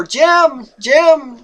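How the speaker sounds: imitating a frightened, high-pitched call; scared calling out. Frightened older lady calling for her son, mock scared voice. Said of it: "in a really scared voice".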